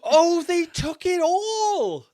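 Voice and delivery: high, loud, slow stunned laugh; laughing in shocked disbelief. Real, not acted. Bans just announced, shocked.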